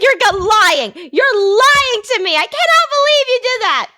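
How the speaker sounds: excited, high-pitched re-enactment; retold screaming disbelief. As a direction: squeal it, reliving the shock. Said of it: "I started screaming bloody murder".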